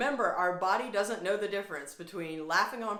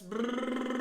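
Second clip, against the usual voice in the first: a buzzing brrr through loose lips; lip trill; 4 semitones higher than usual. Shown as a demonstration. A teacher showing the class, a lip trill. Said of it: "blow out the lips".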